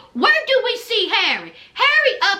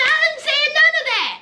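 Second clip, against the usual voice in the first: higher, quicker pleading woman's voice; higher mock Indy; 6 semitones higher than usual. Host voicing Indy pleading with Harry, higher and quicker. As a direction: pitch it up and rush it like Indy. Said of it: "And so Indy said".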